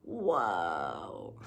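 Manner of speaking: stunned by the fire; awed whoa. Real, not acted. Reacting to fifty forests burning.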